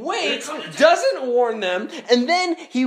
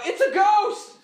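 high-pitched cry of alarm; hammed-up panic, pitched way up; mock fearful cry; 9 semitones higher than usual. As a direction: imitate terrified men crying out. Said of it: "In fear, they cry out".

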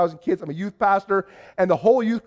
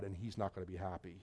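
very low, hushed ominous warning; imitating a solemn monotone, almost under the breath; grave warning; 12 semitones lower, 23 dB quieter than usual. Church man warns visitor about the pastor, grave warning.

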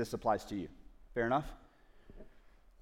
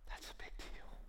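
whisper; 16 dB quieter than usual. Real, not acted. Whispered aside after quote.

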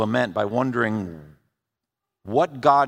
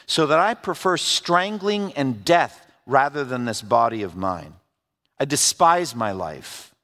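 scripture reading; 3 semitones higher than usual. Acted. Pastor reading Job's complaint to the congregation, earnest scripture reading. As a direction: read Job's lament with weight.

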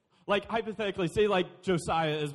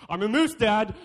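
put-on louder, stubborn little boy; insistent kid; 3 semitones higher, 7 dB louder than usual. Kid insisting to his dad.

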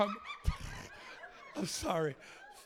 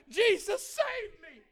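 mock desperate plea; 10 semitones higher, slower than usual. Imitating girl after vodka begging Jesus, high mock plea. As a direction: cry out for rescue, hammed-up. Quotes girl's cry after drinking.

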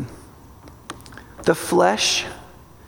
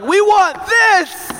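high, slow, loud demanding whine; the flesh shouting its craving; 13 semitones higher, 3 dB louder, much slower than usual. Acted. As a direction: stretch it out like a greedy child. Flesh voiced as whiny demand.

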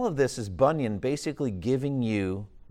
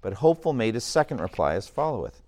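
story reading; 6 dB louder than usual. A narrator's reading. Reads narration of the giant.

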